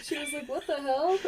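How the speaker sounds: high, mock startled dog voice; voicing the dog. Real, not acted. Owner voicing her scared dog, high and squeaky. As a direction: play the spooked dog, high.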